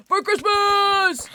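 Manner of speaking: high, loud drawn-out battle cry. Real, not acted. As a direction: yell it as a charge.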